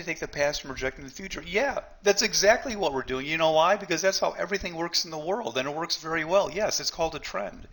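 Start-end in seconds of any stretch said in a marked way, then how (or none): none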